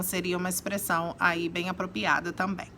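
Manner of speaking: usual speaking voice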